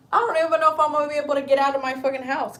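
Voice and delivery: fast, laughing retelling to a friend; laughing quote. Retold or imitated. Telling her friend she can't leave the house, laughing fast. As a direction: blurt it out with a laugh, quickly. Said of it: "I was like".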